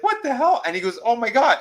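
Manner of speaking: high, loud astonished outburst; mock amazement. Recapper as man hearing his pet talk, mock amazed. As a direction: act out being stunned, voice jumping up.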